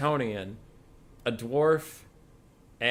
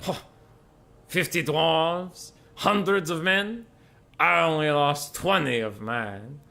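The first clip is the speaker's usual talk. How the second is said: scoffing Bretonnian; 5 semitones higher, 4 dB louder, slower than usual. Acted. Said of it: "the Bretonnian kind of scoffs".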